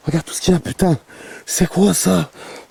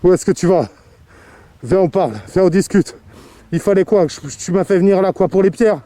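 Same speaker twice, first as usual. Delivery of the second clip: loud, higher, demanding answers; calling out; 4 semitones higher, 6 dB louder than usual. Real, not acted. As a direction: challenge the spirit to speak. Calling out to a spirit.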